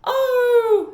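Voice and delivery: high loud howling "Oh"; dog howl. Acted. Winn-Dixie howling from outside the church. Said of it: "said Winn-Dixie".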